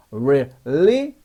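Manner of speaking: really drawn out, tongue pulled back; slow crisp really. Shown as a demonstration. Teacher models really slowly for the class. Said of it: "경쾌하게 혀를 당겨서 really".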